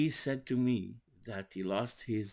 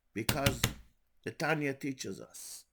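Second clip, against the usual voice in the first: ordinary delivery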